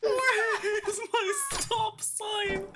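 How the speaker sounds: high, slow whiny laugh-crying. Real, not acted. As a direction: wail it while laughing. Just died in the game.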